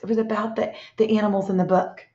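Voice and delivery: ordinary delivery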